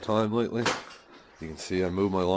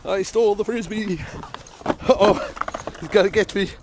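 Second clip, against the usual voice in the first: high, loud singsong teasing; playful teasing dog voice; 10 semitones higher, 4 dB louder than usual. Real, not acted. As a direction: tease the dog over the bottle.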